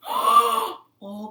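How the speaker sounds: high, loud gasped oh; big hammed-up dismay; alarmed frog. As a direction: react with storybook alarm. Frog reacts to beetle plan.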